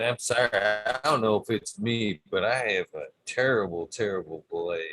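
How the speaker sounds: normal talking voice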